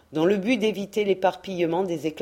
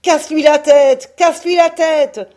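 higher, much louder bloodthirsty chant; howling crew; 9 semitones higher, 11 dB louder than usual. A narrator's reading. Crew howling at their leader in the fight, bloodthirsty roar. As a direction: roar it like a mob of sailors. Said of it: "les hommes hurlaient".

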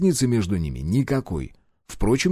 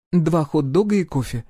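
wife voice; 6 semitones higher than usual. A narrator's reading. Wife orders.

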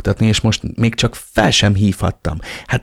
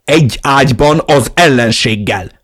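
loud, higher-pitched outrage; angry, raised voice; 5 semitones higher, 6 dB louder than usual. A narrator's reading. Narrator scolds sister for sleeping with the enemy, angrily. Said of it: "Emeltem fel haragosan a hangom".

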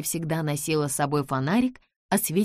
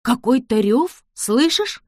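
alarmed shout; 6 semitones higher, 7 dB louder, slower than usual. Acted. George hears roar.

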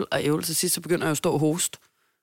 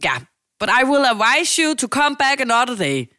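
mock officious clerk; 7 semitones higher, 5 dB louder than usual. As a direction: imitate a bossy receptionist brushing you off. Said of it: "så var hun sådan".

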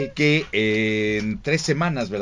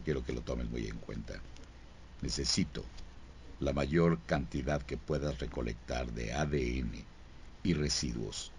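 low, soft serious agent briefing; stern detective giving orders; CSI investigator voice; 9 semitones lower, 7 dB quieter than usual. Acted. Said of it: "así empieza él".

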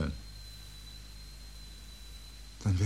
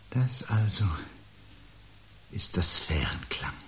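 hushed, awed whisper; a whisper of wonder at the sound; 3 dB quieter than usual. Acted. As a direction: breathe it out in awe. Awed hush at the strange tone.

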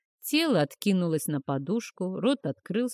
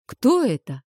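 high, loud frightened question; alarmed; 6 semitones higher, 7 dB louder than usual. A narrator's reading. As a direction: push it out in alarm. Frightened apprentice.

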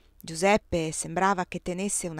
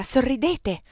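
higher, insistent command; irritated urging, voiced rather than whispered; angry whisper; 6 semitones higher, 4 dB louder, slower than usual. A narrator's reading. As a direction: press him again, mildly cross. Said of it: "gli bisbigliai incollerita".

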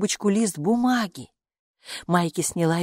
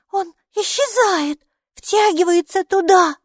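put-on high, slow, loud child's cry; exclaiming child; 13 semitones higher, 6 dB louder, slower than usual. A narrator's reading. Майки sees the root vanish, high exclamation. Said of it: "воскликнула она".

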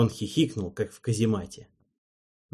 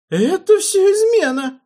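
very high, loud falsetto shriek; 11 dB louder than usual. A narrator's reading. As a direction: scream it in a cracking head voice. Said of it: "выкрикнул Наджел фистулой".

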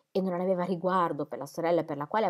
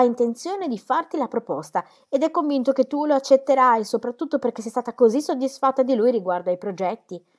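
higher and louder, pressing her point; a sister lecturing, voice rising and louder; Celia insisting; 4 semitones higher, 7 dB louder than usual. A narrator's reading. Celia telling Dorothea that Sir James will propose, louder and higher.

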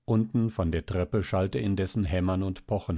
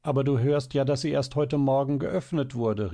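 higher, urgent objection; exclaiming; 6 semitones higher than usual. A narrator's reading. Mother contradicting the child about the door, exclaiming. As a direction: exclaim it, pitch lifted. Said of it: "rief die Mutter".

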